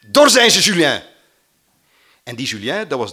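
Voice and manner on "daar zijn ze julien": loud shout, higher, to someone far off; calling out. Retold or imitated. Old classmate calling the farmer, a loud call out. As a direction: yell the alarm across the yard. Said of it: "En die riep".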